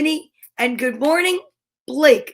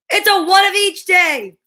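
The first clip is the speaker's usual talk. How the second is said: excited reveal; 6 semitones higher, 4 dB louder than usual. Acted. Announcing the vote result.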